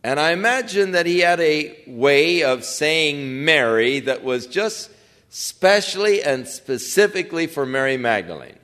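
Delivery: ordinary talk, nothing marked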